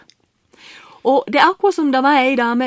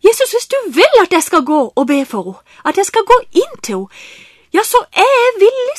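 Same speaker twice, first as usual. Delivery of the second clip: rising higher and louder to a cry of willing; fervent surrender; 7 semitones higher, 5 dB louder than usual. Retold or imitated. To Jesus, fervently crying out his willingness. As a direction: offer yourself, building to the last word. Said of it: "Og så sa jeg".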